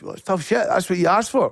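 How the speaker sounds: defiant retort. Retold or imitated. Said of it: "I said".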